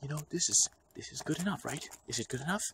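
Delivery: high, unsure questioning; mock insecurity. Imitating himself seeking approval, high nervous doubt. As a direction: fret nervously over the joke. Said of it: "and I'm like".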